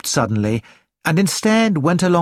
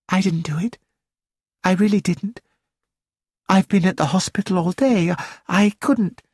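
higher-pitched, mildly worried protest; anxious denial; 5 semitones higher than usual. A narrator's reading. Celia answering Nigel's accusation, mildly anxious denial. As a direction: plead innocence in a small high voice.

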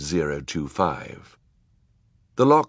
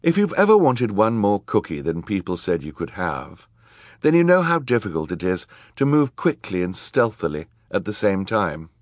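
ordinary delivery, nothing marked

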